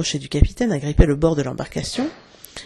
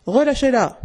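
higher, commanding call; mock captain's order; 7 semitones higher than usual. A narrator's reading. Peter as Crochet ordering Smee, commanding call. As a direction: order them like a pirate captain.

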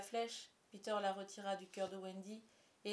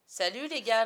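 louder, punchy hail; hearty called-out greeting; 2 semitones higher, 13 dB louder than usual. A narrator's reading. Peter greets the boys on landing, hearty call. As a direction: toss out a loud hello to the gang. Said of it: "lança-t-il".

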